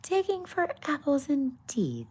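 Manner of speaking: hammed-up huffy squeak; angry rabbit. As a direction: snap it back as a cross little rabbit. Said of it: "said the rabbit angrily".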